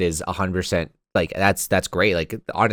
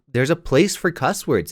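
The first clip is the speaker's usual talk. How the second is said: ordinary talk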